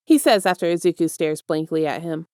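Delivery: plain reading voice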